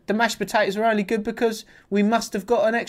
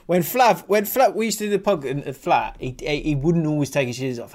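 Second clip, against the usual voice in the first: ordinary talk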